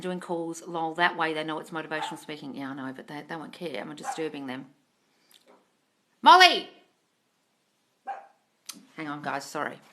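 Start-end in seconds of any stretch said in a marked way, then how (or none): 6.2-6.7 higher, loud call of the dog's name; loud call to a barking dog. Real, not acted. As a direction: shout her name to call her in.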